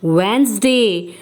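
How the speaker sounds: Wednesday slowly as "Wensday", no D sound; drawn-out Wednesday, the D left silent; slow correct pronunciation. Shown as a demonstration. Teacher repeating for students, slow "Wensday". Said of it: "I am repeating".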